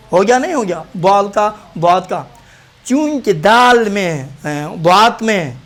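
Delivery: ordinary talk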